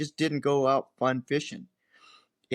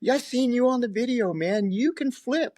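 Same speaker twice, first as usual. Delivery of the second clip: higher-pitched accusing rival voice; 10 semitones higher than usual. Retold or imitated. Angler mocking his rival on the phone, higher-pitched teasing. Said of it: "He goes".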